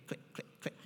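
soft unvoiced clicking sounds made with the tongue; imitated pen clicks.

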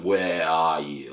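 drawn-out Aussie "where are you", flat-mouthed; Australian accent. Shown as a demonstration. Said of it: "we're all, like, down here".

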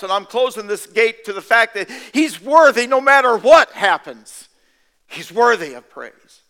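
loud, rising preacher's exhortation; declaring praise to the congregation, loud and rising; fervent preaching. Acted. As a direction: preach it, pushing harder each line. Preaching at full force.

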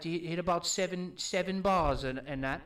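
British lad recounting a pub crawl; broad put-on British accent; British accent attempt. Shown as a demonstration. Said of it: "That's pretty good".

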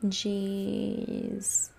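ordinary talk

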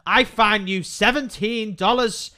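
slow, loud, self-important pronouncement; mock official fine. Friend voices a fake fine sent to the woman, slow and loud. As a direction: read it like a pompous official notice. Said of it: "send it to her on Facebook and say".

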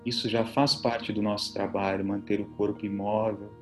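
soft, low relaxation voice; soothing spa whisper. Acted. Leads guided relaxation over music.

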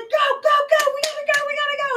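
high, loud frantic shout; panicked urging. Retold or imitated. Tim fleeing Missy on the boat, panicked yelling. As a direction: urge everyone on in a mock panic. Acting Tim's panicked escape.

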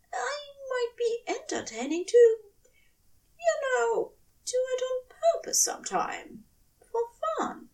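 high, hushed devoted angel voice; tender angel. A narrator's reading. Angel vowing to his lover, high and hushed.

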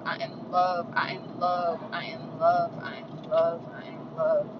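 quiet, steady mantra; low murmured self-love mantra; soft repeated affirmations. Acted. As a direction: chant it softly to yourself. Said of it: "repeated self affirmations".

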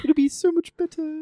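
high, airy fantasy troll voice. Acted. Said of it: "the troll voice".

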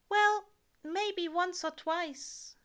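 an angel owning up to a small sin; sheepish angel voice. A narrator's reading. Aziraphale admits sheepishly.

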